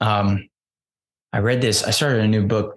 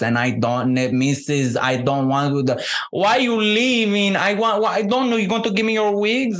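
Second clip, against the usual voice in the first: put-on higher, sing-song manservant accent climbing as he frets; imitating the flustered Miami manservant from The Birdcage, pitched up; Agador Spartacus voice; 8 semitones higher than usual. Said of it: "And he talks like this".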